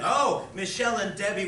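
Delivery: high, loud earnest protest, imitated; missing the joke. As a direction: protest as if taking the joke seriously. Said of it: "Ted doesn't get the joke".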